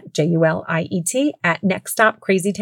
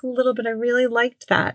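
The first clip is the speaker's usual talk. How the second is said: put-on sad little boy; 5 semitones higher than usual. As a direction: play the disappointed young son. Sad child quoted by mom.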